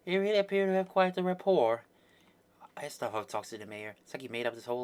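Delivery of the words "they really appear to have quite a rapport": observing character. Acted. Acted observer in dubbed dialogue.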